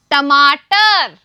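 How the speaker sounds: stretched out syllable by syllable, टमाटर; slow model word. Shown as a demonstration. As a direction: drag टमाटर out slowly for the class. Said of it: "तो सभी बोलिए". (टमाटर धीरे-धीरे खींचकर)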